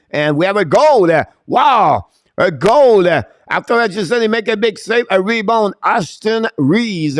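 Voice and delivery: high, loud cry with drawn-out key words; excited goal call. Real, not acted. Commentator announcing a Toronto goal, loud and excited. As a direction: belt out the goal, amazed and thrilled.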